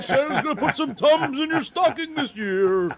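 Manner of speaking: high, loud laughing ho ho ho; playful Santa voice promising Tums; jolly Santa. Pretend. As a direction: boom it out like a merry Santa. Plays Santa for his friend.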